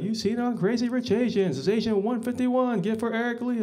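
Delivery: hyping the crowd like a host; mock emcee. Comic mocking the rival emcee, hyped announcer voice. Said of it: "They'll be like".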